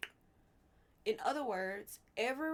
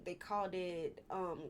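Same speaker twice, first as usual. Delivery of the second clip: ordinary talk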